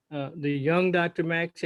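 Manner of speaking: put-on young leaned on with a slight lift; stress on young. Shown as a demonstration. Host thanking the young speakers, stressing young. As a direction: give young a knowing extra weight. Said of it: "I emphasize the word young".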